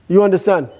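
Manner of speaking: ordinary delivery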